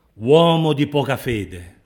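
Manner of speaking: slow, loud, stretched-out reproach; loud rebuke. Acted. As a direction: call out the rebuke, drawing out "Uomo". Voicing rebuke, then repeating it softly.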